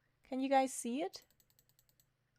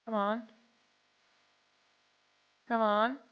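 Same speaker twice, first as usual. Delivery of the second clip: normal talking voice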